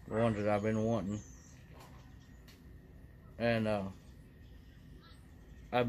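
talking around pipe stem; speaking while smoking pipe; pipe in mouth. Real, not acted. Seen 0: Pipe stem held between lips throughout; smoke exhaled near end.

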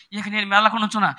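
ordinary talk, nothing marked

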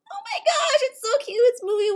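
high, loud squeal over a cute pet; high, loud thrilled gushing; excited squeal. Real, not acted. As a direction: gush at it, delighted. First sees her cute pet.

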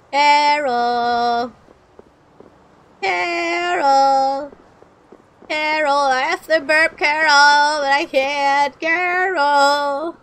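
drawn-out calls for a lost Carol; playful searching holler, held long; calling out. Pretend. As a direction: call out Carol's name, stretching the vowels.

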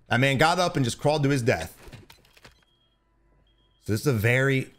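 ordinary talk

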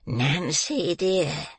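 put-on old man's drawn-out croaky endearment; croaking. A narrator's reading. Old man collected after his rage, croaking to the girl. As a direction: croak it coaxingly.

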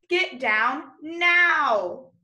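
slow, loud, stretched command; firm teacher command; urgent command. Shown as a demonstration. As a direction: say it like you mean it right now. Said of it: "When someone wants you to do it super, super quick".

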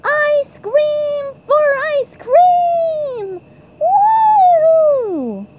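long, stretched-out yell; diving battle cry. Acted. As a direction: draw each word out into a whoop. Yelling while diving.

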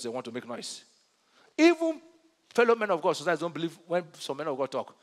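normal talking voice

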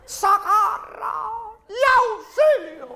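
drawn-out cries of socorro and auxilio; calling for help. Acted. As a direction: yell for rescue from inside the locked theatre. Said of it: "y oímos".

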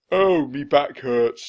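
loud, slow pirate moan; pained cry. A narrator's reading. Stinky complains of a sore back, crying out loudly. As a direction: cry out over an aching back. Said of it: "Stinky cried".

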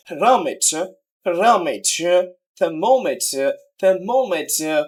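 "thermometer" slowly, stress on MOM; stress on third-last. Shown as a demonstration. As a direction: lean on the MOM of "thermometer", falling after. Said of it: "third from the last syllable". ("thermometer"-এর MOM অংশে জোর)

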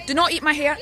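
usual speaking voice

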